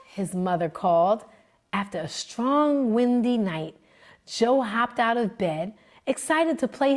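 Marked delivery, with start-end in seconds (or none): none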